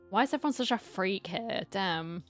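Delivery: normal talking voice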